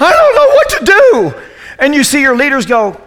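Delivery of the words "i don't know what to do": high, loud mock panic with a laugh; freaking out. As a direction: squeal it like a panicking student. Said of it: "you're freaking out and you're like".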